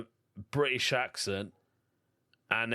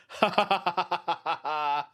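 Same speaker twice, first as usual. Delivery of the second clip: higher, rapid-fire belly laugh; staccato laugh; 5 semitones higher, 3 dB louder than usual. Real, not acted. Host amused by listener faking confusion over "sidewalk", staccato laugh. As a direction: burst out in a long rattle of ha-has.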